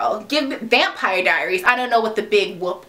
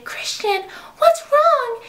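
high, rising mock worry; mock concerned girl; slower than usual. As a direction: squeak it out like a worried girlfriend. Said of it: "and she's like".

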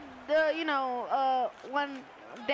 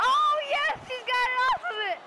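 high, thrilled exclamation; delighted high outburst; excited cheer; 7 semitones higher than usual. Real, not acted. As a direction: cheer the play on, pitch jumping up. Cheering play in game.

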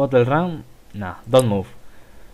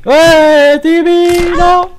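high, loud yelp at the crash; panicked yell; 10 dB louder, much slower than usual. Real, not acted. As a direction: shriek as Timmy goes down. Cheering the rider, he crashes. (grito agudo de pánico)

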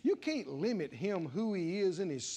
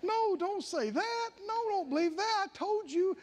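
urgent plea; 6 semitones higher, slower than usual. Acted. Preacher voicing the Lord, pleading.

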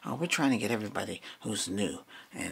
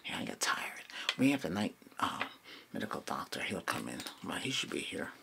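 whispering; 7 semitones lower, 6 dB quieter than usual. Acted. ASMR roleplay whispering.

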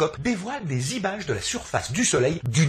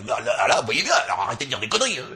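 rapid annoyed telling-off; fast irritated scolding; 6 semitones lower, faster than usual. Acted. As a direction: snap at the audience quickly.

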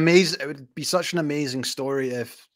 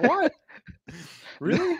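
high-pitched retold disbelief; mock astonishment; 14 semitones higher than usual. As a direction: act out being amazed, pitch shooting way up. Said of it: "I'm like".

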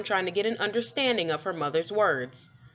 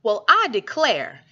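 mild gasp; 4 semitones higher, 6 dB louder than usual. Acted. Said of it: "Miss Tussleberry gasped".

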